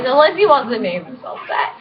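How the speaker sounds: ordinary talk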